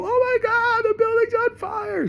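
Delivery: very high falsetto mock scream; mock panicked woman. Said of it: "She will scream and go".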